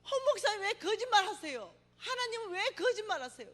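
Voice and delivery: high, loud, slow sobbing outcry; lying on the beach crying out to God; wailing cry. Retold or imitated. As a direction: howl it at heaven, heartbroken. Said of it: "이러면서 울고불고 난리가 난 거예요".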